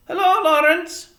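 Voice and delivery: high, loud woman's call. A narrator's reading. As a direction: sing out a greeting from afar. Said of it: "called a woman from the front".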